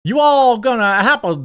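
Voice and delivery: high, loud, hammed-up Southern accent; mock Southern drawl. Host mocking Harris at the rally, mock Southern drawl. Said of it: "That's not how she talks".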